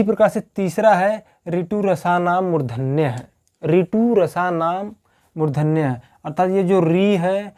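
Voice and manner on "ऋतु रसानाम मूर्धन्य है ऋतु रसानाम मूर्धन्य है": slow sutra recitation. Shown as a demonstration. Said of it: "teacher recites Sanskrit sutra".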